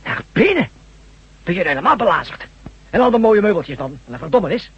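high, loud, indignant disbelief; outraged disbelief. Acted. Man protesting to his friend about bringing the bleeding gangster inside, outraged. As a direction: protest loudly, shocked at the idea.

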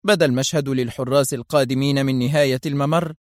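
plain reading voice

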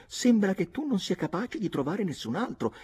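put-on high, teasing young woman's voice; Nora's taunting voice. Nora mocks Redmond, in a high teasing voice. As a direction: needle him in a girl's lilting voice. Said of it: "disse Nora".

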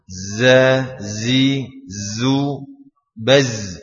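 za zi zu baz with a long buzzing z. Shown as a demonstration. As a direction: buzz each z like a bee. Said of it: "Kita baca". (dengung z seperti lebah)

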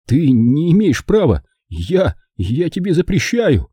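high, distraught objection; desperate protest. Acted. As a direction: forbid it desperately, stumbling on the words. Nephew forbids dying uncle to die.

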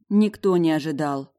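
ordinary delivery

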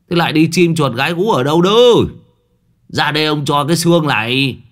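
loud, high scolding call; yelling for the dog. A narrator's reading. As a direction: shout across the yard for the dog. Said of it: "Lão quát to". (quát gọi chó, giọng cao và to)

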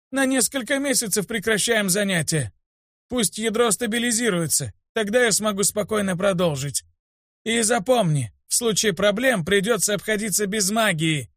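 высокий голос строгого старого учителя; наставляй и предостерегай ученика стариковским голосом; сварливый старик. A narrator's reading. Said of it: "сварливо сказал он". (высокая ворчливая речь старика)

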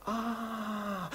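ordinary delivery

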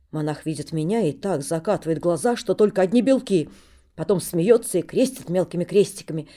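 usual speaking voice

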